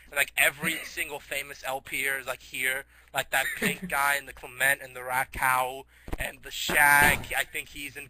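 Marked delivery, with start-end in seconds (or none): none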